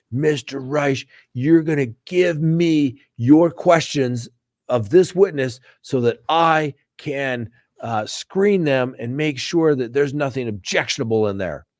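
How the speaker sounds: mock stern judge. Imitating the colonel judge ordering the lawyer to hand over questions, slow and pompous. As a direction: lord it over the lawyer like a haughty military judge. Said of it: "the judge was like".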